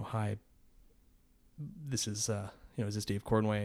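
hesitant, hushed and grave phone introduction; somber caller. Retold or imitated. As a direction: put on a subdued, somber caller's voice. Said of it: "really somber voice comes on the phone".

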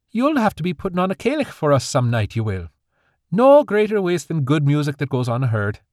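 jovial grandfather. Acted. Grandfather urging granddaughter to perform, jovially. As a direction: cheer her on like a fond grandad.

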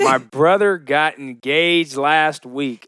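slow announcement. Real, not acted. Announcing happy news.

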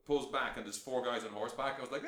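ordinary talk

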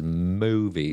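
drawn-out mock British accent, lifting at the end; playful British movie-spoof accent; Austin Powers. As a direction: stretch the word like a British spy spoof. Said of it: "that's the Austin Powers accent".

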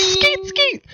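very high squealing falsetto; squeaky falsetto cry. Pretend. Joke climax exclamation.

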